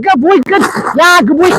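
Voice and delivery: high-pitched excited praise; real high, eager cheering to a dog. Real, not acted. Trainer praising dog in play.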